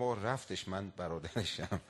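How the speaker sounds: small, quiet, sheepish joke voice; mock timid reply. Young preacher dodging the old cleric, sheepish and quiet. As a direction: shrink and mumble as if scared.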